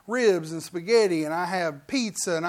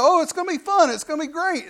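higher-pitched, mock-excited chatter; eager kid impression; 7 semitones higher, 4 dB louder, faster than usual. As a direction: play a kid hyped for the ride. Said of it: "kids that they put on a roller coaster and they go".

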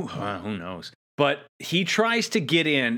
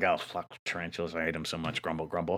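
much softer, low grumbling mutter under the breath; mumbling to herself; 9 semitones lower, 10 dB quieter than usual. Retold or imitated. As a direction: grumble it to yourself, softer and lower. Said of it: "mumbling to herself".

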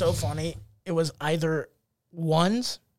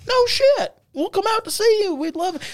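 high, delighted retold reaction; imitated excited fan; 14 semitones higher than usual. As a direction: act out a thrilled new fan. Friendly fan reacts to comic's show.

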